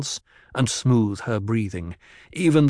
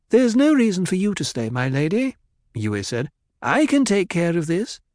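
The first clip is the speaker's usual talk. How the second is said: doctor's voice; 8 semitones higher than usual. A narrator's reading. Yui the doctor reassures.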